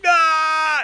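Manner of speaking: high, loud mock death scream; imitated scream. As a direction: reenact screaming while being bitten. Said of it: "And I scream".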